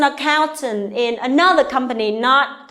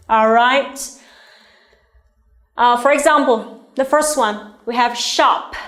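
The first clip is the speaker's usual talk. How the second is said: ordinary delivery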